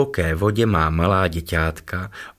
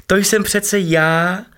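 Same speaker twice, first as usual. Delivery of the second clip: much higher, louder and slow; joyful cry; 12 semitones higher, 4 dB louder, slower than usual. A narrator's reading. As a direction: cry out with delight. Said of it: "vykřikl radostně". (radostné zvolání, vysoko a hlasitě)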